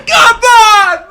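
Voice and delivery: loud, high cry of hype; real excited yell; hyped shout. Real, not acted. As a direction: shout it at the screen. Hyped shout after the verse.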